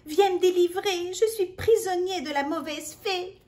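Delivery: high, loud cry for mum; a trapped boy shouting for his mother; yelling for help. A narrator's reading. Victor calls out to mum, crying loudly. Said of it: "Victor hurle".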